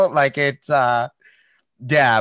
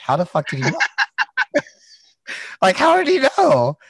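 laughing; 12 semitones higher, 3 dB louder than usual. Retold or imitated. Incredulous laugh retelling surprise.

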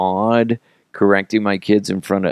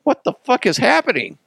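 put-on much higher, baffled kid voice; shocked kids. Kids watching a girl yanked off, shocked high voice. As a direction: gasp it like confused kids. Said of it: "the other kids are like".

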